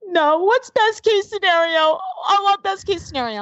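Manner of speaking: put-on high, whiny little-kid voice; imitated upset child. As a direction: plead like a distressed small child. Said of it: "And he's like".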